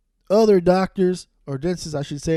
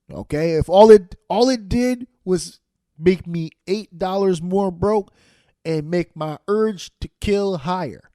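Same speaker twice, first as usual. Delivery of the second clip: ordinary talk, nothing marked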